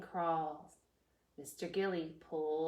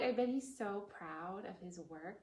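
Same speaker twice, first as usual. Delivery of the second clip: ordinary delivery, nothing marked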